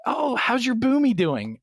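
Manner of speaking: high-pitched chirpy mimicry of a team lead; mock team lead. Team lead asking after the druid, high mock-friendly. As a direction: imitate an oblivious, over-friendly question. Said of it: "There used to be a team lead in the guild".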